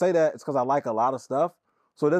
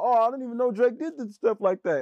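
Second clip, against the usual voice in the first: mock naive listener; 9 semitones higher than usual. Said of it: "hear this album like".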